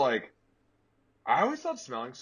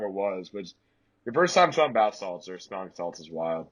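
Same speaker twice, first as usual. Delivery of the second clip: ordinary talk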